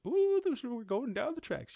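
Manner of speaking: high squeal of a gentle ride; high mock coaster ride. Mocking a tame roller coaster, squealing high. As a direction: squeal going down the tracks. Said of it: "It's not like".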